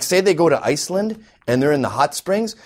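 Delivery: usual speaking voice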